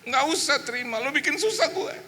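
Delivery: very high whining complaint; imitated aggrieved sibling; blaming. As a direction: complain bitterly at squealing pitch. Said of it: "Adik saya menyalahkan saya".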